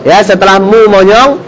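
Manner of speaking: ordinary delivery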